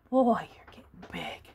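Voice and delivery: whispered and low; soft aside. Real, not acted. Grandma whispers to the grandkids how big they got.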